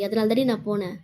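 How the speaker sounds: usual speaking voice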